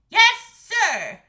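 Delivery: high, very loud obedient reply; eager shout. A narrator's reading. Boy answering the king's order, eager shout.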